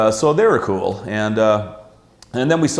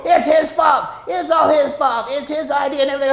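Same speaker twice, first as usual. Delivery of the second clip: high, shrill-sounding finger-pointing, speeding up at the end; a partner frantically blaming his friend; whiny blaming. Retold or imitated. Business partner throwing him under the bus to the guard, high whiny blame. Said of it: "throw you under the bus and go".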